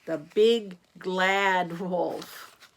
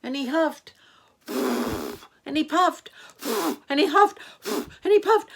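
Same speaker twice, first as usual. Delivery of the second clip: building excitement; 6 semitones higher, 7 dB louder than usual. A narrator's reading. Narrator building the wolf's blowing, rising louder and higher. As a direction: build each line bigger and higher.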